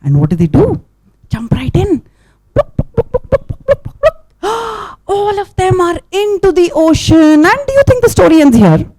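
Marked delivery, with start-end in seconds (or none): 2.5-4.4 higher playful pluk pluk run; high plopping sounds. Acted. Storyteller making turtle splash sounds, high and playful. 4.5-5.0 dramatic gasp. Acted. Story suspense.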